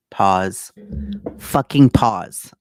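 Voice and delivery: pause, pause, then a loud, slow fucking pause; loud fed-up command to stop; exasperated loud stop. Real, not acted. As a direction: slam the brakes on the reading. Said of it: "I substituted my own word".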